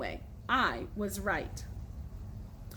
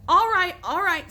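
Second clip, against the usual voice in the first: exasperated; 7 semitones higher, 8 dB louder than usual. A narrator's reading. Billy gives in loudly.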